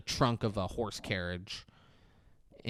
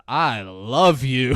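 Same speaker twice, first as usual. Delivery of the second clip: loud, slow, drawn-out confession; hammed-up declaration of love; 7 dB louder, much slower than usual. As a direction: declare it slowly, under mock duress. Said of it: "gets down on his knees and says".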